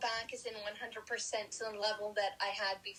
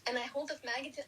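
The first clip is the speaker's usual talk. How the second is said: ordinary talk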